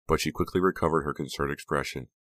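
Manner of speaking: ordinary delivery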